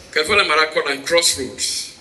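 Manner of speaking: usual speaking voice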